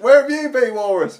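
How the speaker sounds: very high, loud exclamation; excited mock cry. He greets the walrus bursting in, high loud mock surprise. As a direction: shriek the question in hammed-up surprise.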